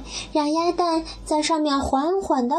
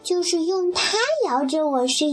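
higher little chick voice, slowing at the end; a chick remembering being rocked; duckling voice, contented and cosy; 4 semitones higher than usual. Acted. Duckling recalling the egg fondly.

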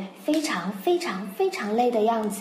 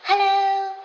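bright, much higher, louder sung hello; cheerful Nellie; 12 semitones higher, 6 dB louder than usual. Acted. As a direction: chirp it like a happy sitter. Nellie answers phone cheerfully.

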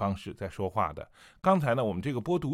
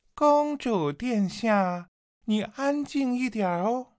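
much higher, very slow, coy and teasing; Yan Lingji, coquettish; 12 semitones higher, 4 dB louder, slower than usual. Shown as a demonstration. Yan Lingji teasing the captive princess, coy, high and slow. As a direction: purr it sweetly like a seductive sorceress. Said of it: "比较妖里妖气的那种感觉".

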